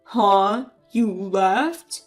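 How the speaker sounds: low disbelieving huh; husband hearing his wife left; stunned husband. Acted.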